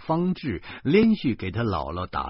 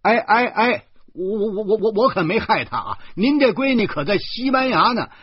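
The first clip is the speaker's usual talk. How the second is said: much higher, loud, stammering denial; panicked protest; 13 semitones higher, 8 dB louder than usual. Acted. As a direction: stumble over the words defending yourself.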